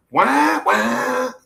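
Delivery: two high "wah" noises; imitated motor wail. Imitating the motor kicking in, high drawn-out wahs. Mimics the kick-started motor.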